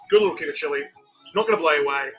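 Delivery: normal talking voice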